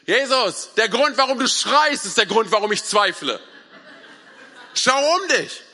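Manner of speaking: loud, high, exasperated yell; shouting back. Acted. Petrus answering Jesus through the storm, shouting back. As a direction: protest at the top of your voice.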